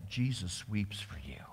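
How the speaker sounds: hushed, sorrowful whisper; preacher mourning the lost chance, whispered. Real, not acted. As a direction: breathe it out, grieving. Climax of weeping passage.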